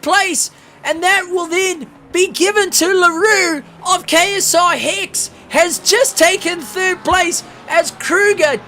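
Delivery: high, loud, urgent news to the crowd; frantic announcement. Real, not acted. Commentator telling viewers Kruger disconnected, frantic. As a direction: announce the shock at full stretch.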